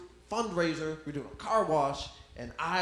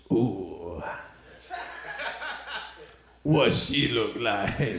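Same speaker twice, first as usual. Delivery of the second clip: low, slow suggestive voice; leering dad; 6 semitones lower, 4 dB louder, much slower than usual. Retold or imitated. Dad hears the kid's mom was alone, low and slow leering. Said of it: "my dad goes".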